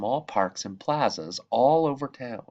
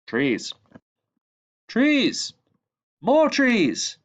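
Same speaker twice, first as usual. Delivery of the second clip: builds from plain to a much higher, louder call; each word climbs higher and louder; growing cry for trees; 12 semitones higher, 4 dB louder, much slower than usual. Acted. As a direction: swell into an eager shout for more. Rising demand for more trees.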